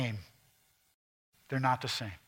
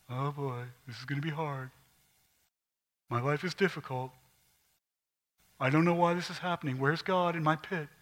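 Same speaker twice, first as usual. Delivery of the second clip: softer, mopey Eeyore moan; 3 dB quieter than usual. Retold or imitated. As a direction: Eeyore grumbling about his pit, in softer mopey groan. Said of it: "Not just Eeyore".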